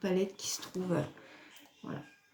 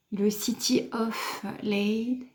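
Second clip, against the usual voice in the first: French-accented English; slower than usual. Real, not acted. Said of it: "Excusez-moi pour mon anglais".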